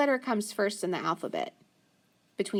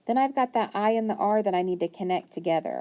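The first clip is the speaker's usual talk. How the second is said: normal talking voice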